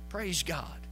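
ordinary talk, nothing marked

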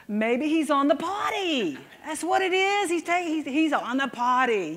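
playful mock delicacy. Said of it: "let me put it delicately".

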